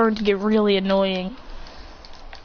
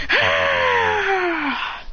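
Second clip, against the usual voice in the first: startled shout; 10 semitones higher than usual. Acted. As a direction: yelp in shock. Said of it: "and go".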